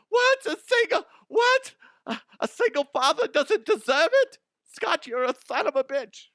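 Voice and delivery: very high, loud, squawking indignation; mock outrage. Outraged listener scolding him over single fathers, mock high-pitched outrage. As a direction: screech it in comic disbelief.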